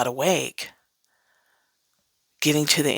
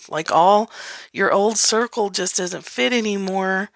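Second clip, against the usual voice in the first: ordinary talk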